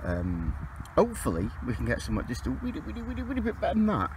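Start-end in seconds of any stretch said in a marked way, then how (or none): 2.5-4.1 high squeak on the repeated wee; silly squeaky wee. Pretend. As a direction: pipe the wees up high, comic. Comic squeaky "wee" run.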